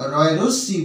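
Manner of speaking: ordinary talk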